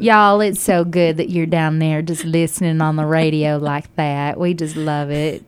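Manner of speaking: hammed-up Texas hello; Texas accent. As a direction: put on a big Texan accent for a shout-out. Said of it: "do a Texas accent".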